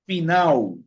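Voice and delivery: final as "fi-NAU". Shown as a demonstration. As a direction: say final with its last L turned into a u. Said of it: "se pronuncia la L como la U".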